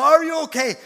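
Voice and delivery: high loud hammed-up panicked cry; mock panic. Grandpa mocking his own panic over hurt grandson. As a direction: play the frantic grandpa. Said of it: "And Joe's like".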